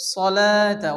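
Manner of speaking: সলাতা said loud and clear with no waw sound; correct reading. Shown as a demonstration. Teacher giving the right reading after the wrong one. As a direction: skip the waw, say সলাতা firmly. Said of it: "তাহলে কি পড়বো".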